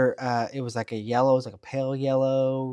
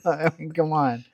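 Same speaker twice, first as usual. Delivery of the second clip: normal talking voice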